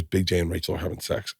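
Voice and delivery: soft imitation of a child's disclosure; retold confiding. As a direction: pass it on softly, acted out. Retold child passing on a secret.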